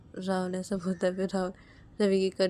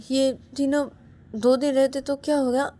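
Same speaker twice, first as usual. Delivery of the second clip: normal talking voice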